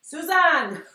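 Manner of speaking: high, loud imitation of a yell; mock affectionate yell. Assistant yelling at her boss across the store, mock yell. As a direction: holler it like an exasperated assistant. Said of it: "the way she yells at me".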